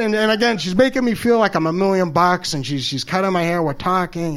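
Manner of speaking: ordinary delivery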